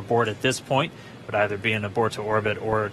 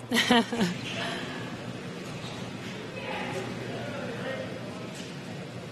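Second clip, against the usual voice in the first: high breathy laugh; 13 semitones higher, 9 dB quieter than usual. Real, not acted. Laughing at zero G toy.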